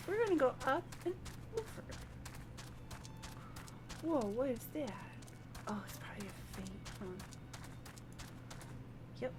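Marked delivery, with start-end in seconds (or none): none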